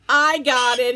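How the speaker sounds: loud triumphant shout. Acted. Wally grabbing the egg, shouting. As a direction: shout it as you grab it. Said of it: "he shouted".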